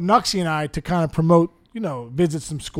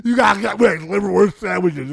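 mock mouth-full talking, loud; Nuxie; 2 semitones higher, 6 dB louder than usual. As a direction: imitate talking through a chewed sandwich. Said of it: "He'd be chewing the liverwurst sandwich".

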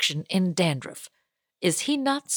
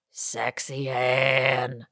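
sultry sign-off; 7 semitones lower, 4 dB quieter, much slower than usual. Acted. Sign-off of read advice letter.

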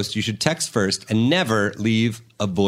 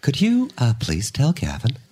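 awkward mom. Retold or imitated. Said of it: "she'd be like".

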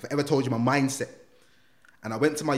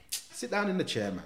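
lightly voiced stern officer, higher; high commanding woman voice; bossy woman's voice; 7 semitones higher, 5 dB quieter than usual. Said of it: "She said".

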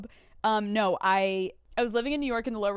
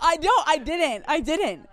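excited protest; 7 semitones higher, 7 dB louder than usual. Real, not acted. Protesting she had no idea.

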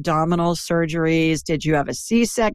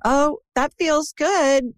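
higher, slow pleased 'oh'; approving gut feeling; 9 semitones higher, slower than usual. Acted. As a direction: act out a pleasant hunch, drawn out. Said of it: "I'll get a gut feeling like".

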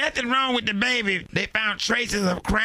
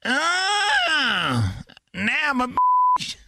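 indignant shout; 3 semitones higher, much slower than usual. Acted. Caller mock outrage.

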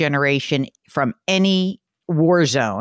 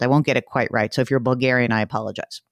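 ordinary talk

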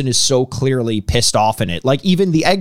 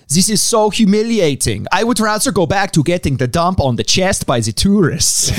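lightly put-on Dutch accent, a bit higher; a hint of a freaky Dutch voice, pitched up; mock Dutch accent; 5 semitones higher than usual. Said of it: "I don't know what accent that was".

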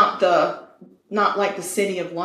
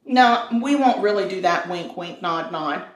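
usual speaking voice

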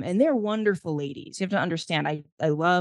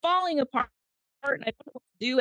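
raw breakdown; 10 semitones higher than usual. Retold or imitated. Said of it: "I was like".

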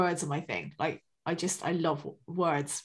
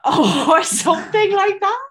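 laughing; 11 semitones higher, 8 dB louder, slower than usual. Real, not acted. Laughing at own absurd sentence.

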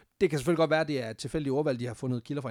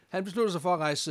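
ordinary delivery

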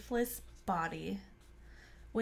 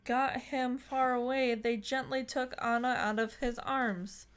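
ordinary delivery

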